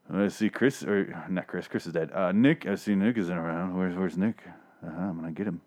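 low, soft mock menacing mutter; quietly scheming villain muttering to himself; Sideshow Bob-like menace. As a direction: imitate a lurking villain, hushed and low. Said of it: "Sideshow Bobbing him".

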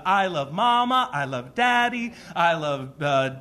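lightly voiced little boy reciting; a hint of a small child's eager roll call; child's love list. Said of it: "when he started doing the".

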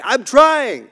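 high, loud, exasperated plea; struggling runner answering the coach; strained protest. Retold or imitated. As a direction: push it out like a kid begging to be understood. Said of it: "And I'm like".

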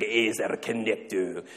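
slow, mock Scottish pronunciation; Scottish accent. Speaker imitating a Hebrew expert, Scottish accent. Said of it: "but he's also Scottish. So he's like".

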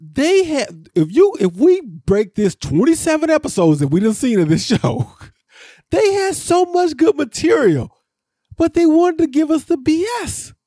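high, worked-up complaining about the show; fed-up podcaster ranting about wasted material; exasperated rant. Real, not acted. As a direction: vent it, pitch climbing with frustration.

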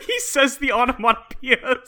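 high, delighted crack-up; laughing. Real, not acted. Host cracking up at Claudio saying sound effects, laughing. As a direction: talk through helpless laughter. Laughing at the absurd comic panel.

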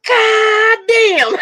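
put-on a delighted toddler blurting out the swear; toddler cursing. Mother imitating her grinning toddler's first curse. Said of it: "she goes".

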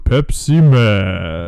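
low, slow, drawn-out announcer; deep Pepsi Man voice. Acted. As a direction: drag it out like a movie trailer.

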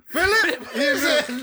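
high and loud through laughter; laughing. Real, not acted. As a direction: howl it while laughing. Cracking up at the Philip joke.

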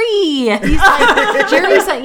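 high, loud laughter through the words; laughing. Real, not acted. Telling the host about meeting a star while laughing, high and loud. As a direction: crack up mid-sentence.

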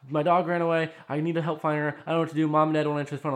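high, loud panicked rush; freaking-out sister. Retold or imitated. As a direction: mimic a frantic plea for help. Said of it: "She's, like, freaking out".

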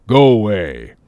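lightly put-on character voice; a harpooner shaking someone off; Queequeg's voice.

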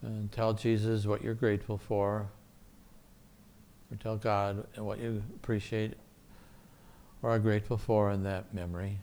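soft, calm guided meditation voice; soft spa whisper. Acted. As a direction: gently guide them through a quiet prayer. Leads guided prayer meditation.

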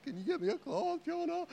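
high, pleading drama-queen whine; milk being unwell for sympathy; whiny sick husband. Retold or imitated. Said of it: "she calls me a drama queen".

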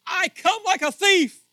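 booming high-pitched proclamation; shouted quote. Retold or imitated. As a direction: proclaim it loudly as a warning. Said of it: "He says".

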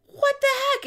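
high, startled what the heck; a sudden high-pitched disbelief; shocked. Retold or imitated. Shocked at driver on phone.

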